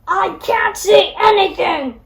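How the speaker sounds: loud blinded wolf roar. Acted. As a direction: bellow in frustration. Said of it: "roared the wolf".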